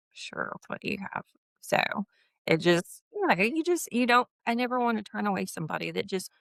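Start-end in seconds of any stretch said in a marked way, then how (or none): 0.1-1.2 soft offer. Retold or imitated. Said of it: "I'm like".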